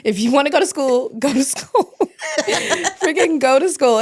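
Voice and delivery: laughing exhortation. Real, not acted. Mother urging herself to keep studying, laughing and loud. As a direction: cheer yourself on, laughing.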